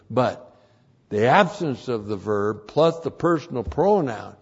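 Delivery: ordinary delivery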